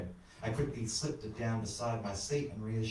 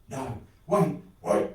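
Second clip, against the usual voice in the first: louder, urgent plea; pleading; 4 semitones higher, 5 dB louder, slower than usual. A narrator's reading. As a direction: beg the driver not to stop. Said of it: "old mate pleaded".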